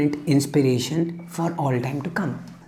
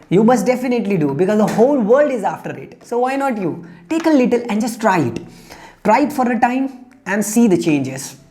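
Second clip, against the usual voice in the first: fast, insistent sales pitch, a little higher; pushy bull; 6 semitones higher, faster than usual. Acted. Bull urges the bird to eat his dung, fast and pushy. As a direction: rush the bird into it, cajoling.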